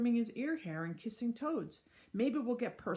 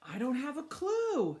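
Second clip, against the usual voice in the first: higher, slow shout; 6 semitones higher, 4 dB louder than usual. Acted. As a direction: shout it out, flustered. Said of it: "shouted Miss Sue".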